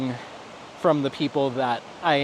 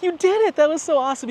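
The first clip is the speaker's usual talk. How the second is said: excited praise; 13 semitones higher, faster than usual. Retold or imitated. Praising scared kid after ride.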